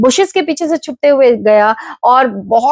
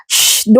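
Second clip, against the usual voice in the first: long "shh"; shushing; 3 semitones lower than usual. Acted. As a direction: hush the speaker with a drawn-out shh. Ravi shushes the loud vendor.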